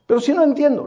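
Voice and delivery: a hint of an exhausted student complaining; whiny young man. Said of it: "este joven me dice".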